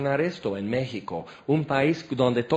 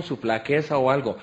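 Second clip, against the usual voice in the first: ordinary talk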